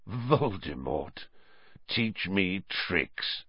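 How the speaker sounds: soft, slow, icy contempt; cold quiet scorn. Acted. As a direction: let the scorn drip slowly.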